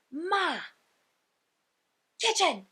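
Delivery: high-pitched, mildly hammed-up little girl's call; excited little girl. Little girl to her mom from the shopping cart, excited. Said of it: "And she's so excited".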